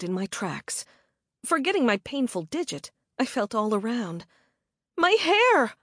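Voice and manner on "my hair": high, louder aghast outcry; horrified at her hair; shocked gasp. A narrator's reading. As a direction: she finds her hair cut, gasping. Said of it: "I gasped".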